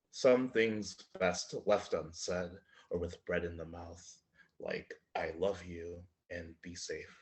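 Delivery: measured, deliberate poem delivery with swells; poetry reading. Acted. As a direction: read it like a poem to a live audience. Poet reads his poem.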